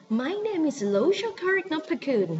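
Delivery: high, swooping English accent on a Thai name; mock anglicised Thai name in English accent; overdone English accent. Shown as a demonstration. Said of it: "Please don't say".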